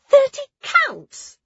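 very high, slow, loud disbelief; astonished gasp. A narrator's reading. Ruli hearing Nona's record time, astonished. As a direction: gasp it back, stretched and squeaking high. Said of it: "Ruli gasped".

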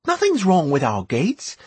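put-on high boyish voice; boy talking eagerly; young boy voice. A narrator's reading.

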